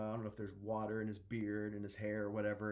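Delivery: ordinary talk, nothing marked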